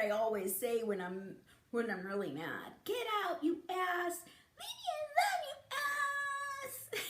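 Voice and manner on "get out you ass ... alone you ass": high, mock furious shooing; mock angry yell. Shown as a demonstration. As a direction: shriek it out in fake anger. Mad swearing demo, pitch rising.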